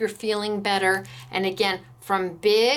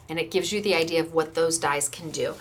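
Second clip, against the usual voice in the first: ordinary talk, nothing marked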